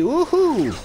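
high, gleeful wouhou; excited whoop. Real, not acted. Gamer whooping to viewers as the level starts, a high excited cheer. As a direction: cheer like you're taking off on a ride. Launching off in the game.

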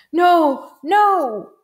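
high, loud cries of dismay; anguished cry. A narrator's reading. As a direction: cry out as the horrified principal. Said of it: "the principal cried".

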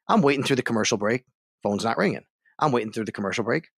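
ordinary talk, nothing marked

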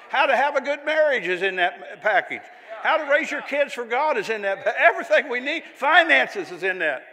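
loud, high, building preacher's crescendo; fiery preaching. Acted. Preaching at full force.

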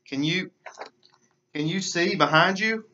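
high, excited little frog voice; a frog crying out in excitement. A narrator's reading. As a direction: call out in a rising, eager squeak. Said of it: "cries the frog".

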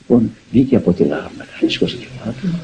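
ordinary talk, nothing marked

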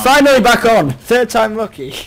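loud, high shout of relief; triumphant shout. Real, not acted. Player announcing he is back on, triumphant shout. Celebrating getting back into game.

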